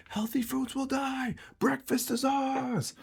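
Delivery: very high, shouted war cry; cartoon cereal mob roaring their victory; screaming cereal army. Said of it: "they're all screaming".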